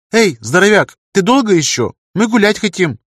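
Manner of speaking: high, loud impatient call; girl hurrying someone along loudly. Acted. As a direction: call across the workshop, pitched high. Girl calls out to busy workman.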